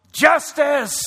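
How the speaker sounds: loud, high crowd yell; yelled cry. Retold or imitated. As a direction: shout it out like a fan in a stadium. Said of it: "somebody among the thousands of fans yelled".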